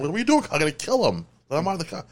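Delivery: high-pitched panicked brother; mock panicked brother. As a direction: squeak it out like a frantic big brother. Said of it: "My brother, like".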